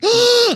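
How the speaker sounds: high, hammed-up gasp; dramatic gasp. Customer looking at the new haircut, dramatic gasp. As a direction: suck in a high breath for effect.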